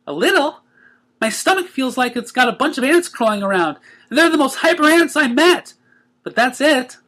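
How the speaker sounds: high, bubbly cartoon pony voice; chirpy excited pony; Pinkie voice. A narrator's reading. Pinkie telling the Cakes how she feels, high and bubbly.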